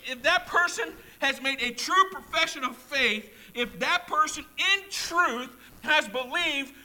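high, loud impassioned preaching; fiery pulpit preaching; preaching at full force. Acted. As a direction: build it to a fervent cry. Preacher voicing God, building intensity.

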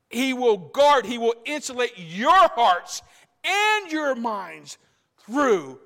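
high, loud, slow rising preacher cadence; full-force preaching. Acted. Preacher to congregation about God's peace, full-force preaching. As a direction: build it up to the congregation.

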